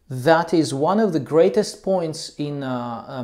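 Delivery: ordinary delivery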